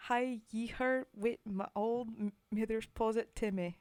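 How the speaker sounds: put-on loud, rough try at a Scottish accent; attempted Scottish accent. Streamer reading a poem to chat in an attempted Scottish accent. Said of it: "I have an attempt at an accent".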